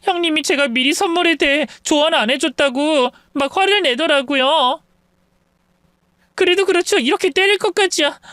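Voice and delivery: high-pitched, put-on weepy whining; fake tearful. Sister-in-law complaining to parents-in-law, falsely tearful. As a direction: squeeze out tears to play the victim. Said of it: "눈물을 쥐어짜내더군요".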